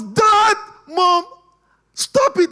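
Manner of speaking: very high, loud desperate yell; a small boy screaming at his parents; child's scream. Retold or imitated. Boy screaming at quarreling mom and dad to stop. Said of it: "the guy was shouting on them".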